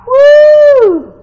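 long, high, loud woo; drawn-out celebratory holler; exultant whoop. Real, not acted. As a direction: whoop with praise. Exultant whoop after proclamation.

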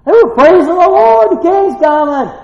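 loud, high, jubilant shouting; excited praise. Acted. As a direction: shout it like a celebrating crowd. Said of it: "they were getting a little excited".

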